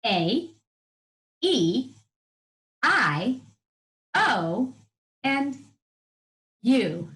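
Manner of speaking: each vowel said alone with pauses; slow vowel list. Shown as a demonstration. Teacher listing vowels slowly for children. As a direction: count out the vowels one by one. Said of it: "vowels are those special letters".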